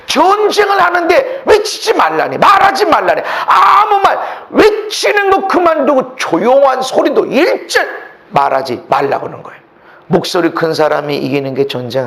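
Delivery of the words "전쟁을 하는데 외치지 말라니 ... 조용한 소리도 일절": loud, high, impassioned sermon voice; fiery preaching. Acted. Preacher pressing the congregation on the strange command, fiery preaching. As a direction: preach it hard to the back row.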